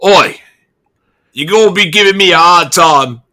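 loud, threatening town guard; guard threatening the armed, bleeding stranger, loud; tough guard. Acted. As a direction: square up like a bully in uniform. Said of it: "and he goes".

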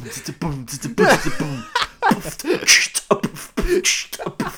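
hissy mouth-drum groove; beatbox kick and cymbal noises. Retold or imitated. Student drums with his mouth instead of playing, beatboxing. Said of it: "Are you a fucking human beatbox".

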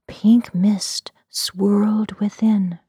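ordinary delivery, nothing marked

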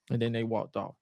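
normal talking voice

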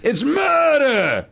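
high, long-drawn melodramatic exclamation; mock-dramatic cry. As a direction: stretch MURDER out like a hammy reveal.

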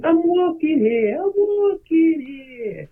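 high, slow drawn-out yell; cartoon New York yell. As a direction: stretch it out like a cartoon character. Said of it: "a character running around going".

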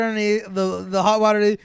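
ordinary talk, nothing marked

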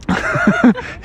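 high cartoon villain laugh; villain giggle. Insane Bear laughs.

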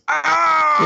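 loud high mock yell "ah"; hammed-up cartoon scream. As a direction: yelp like you got hit. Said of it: "he goes".

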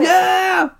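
high, loud crowd holler; whooped, high-pitched "yeah"; cheering kids. Retold or imitated. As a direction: cheer it out like an excited kid. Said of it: "All these kids are like".

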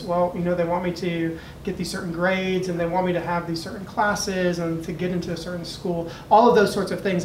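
ordinary talk, nothing marked

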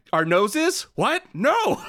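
high, silly clueless answer; goofy Luffy. Retold or imitated. Luffy blurting a dumb answer to the villain, high and goofy. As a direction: blurt it out like a dim hero. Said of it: "And he's like".

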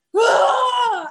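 high, loud attacking yell; mock warrior shriek; reenacted battle cry. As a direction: act out a machete-swinging scream. Acting out attacking snake with machete.

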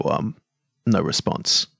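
ordinary delivery

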